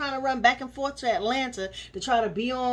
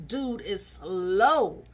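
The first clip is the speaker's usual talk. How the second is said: dragged-out put-down; 2 semitones lower, much slower than usual. Real, not acted. Mocking a reality star.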